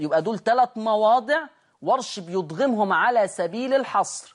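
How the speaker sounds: normal talking voice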